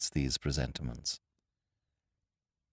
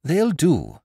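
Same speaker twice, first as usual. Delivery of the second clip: higher, louder eager reply; 9 semitones higher, 7 dB louder than usual. A narrator's reading. Wooster accepting the cigarette eagerly, in a higher, louder voice. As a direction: grab at the offer. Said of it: "with no little eagerness".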